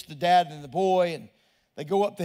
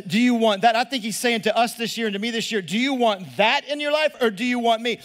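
louder, driving preacher's build; preaching at full force; 7 dB louder than usual. Acted. Pastor pressing the congregation, preaching at full force.

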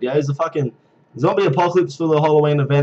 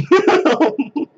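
squealing laugh, much higher; 14 semitones higher, 3 dB louder than usual. Real, not acted. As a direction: break into a giggling fit. Laughing at confused players.

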